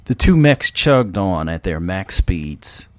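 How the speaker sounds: ordinary delivery, nothing marked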